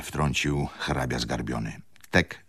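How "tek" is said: plain reading voice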